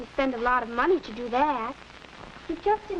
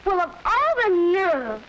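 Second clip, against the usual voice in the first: slow, offended and a little louder; indignant; 4 semitones higher, 4 dB louder than usual. Acted. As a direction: bristle at the cheek of it. Girl offended by rude asking.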